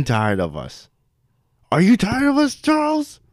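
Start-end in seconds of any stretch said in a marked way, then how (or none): 1.7-3.2 whisper yelling. Acted. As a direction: scream it without raising your voice. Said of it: "Why are you whisper screaming?".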